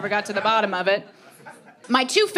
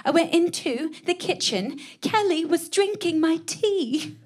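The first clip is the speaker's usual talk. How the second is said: put-on higher, fussy complaining British accent; 8 semitones higher than usual. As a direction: whine to Katie in a British accent about stolen tea. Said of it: "she would always complain to me, like".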